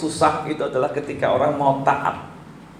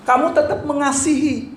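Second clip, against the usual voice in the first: ordinary talk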